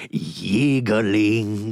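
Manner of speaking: "yiggling" with a y for the g, stretched out; Ricardo Montalban accent. Acted. Correcting his own impression, "yiggling" in exaggerated Montalban accent. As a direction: say "yiggling" slowly, starting on a y sound. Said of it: "I should rather, I should say".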